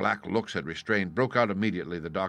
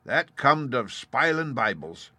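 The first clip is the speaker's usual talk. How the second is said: slow pirate; 5 semitones higher, 5 dB louder, slower than usual. A narrator's reading. Morgan speaking.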